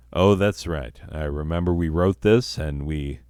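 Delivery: ordinary talk, nothing marked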